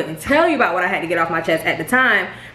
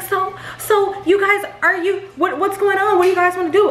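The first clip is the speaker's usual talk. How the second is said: mock realtor; 9 semitones higher than usual. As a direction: stammer like a caught-out agent, pitched up. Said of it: "And she was like".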